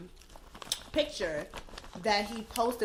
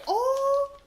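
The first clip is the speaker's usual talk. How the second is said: shocked; 13 semitones higher than usual. Retold or imitated. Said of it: "I was shookest".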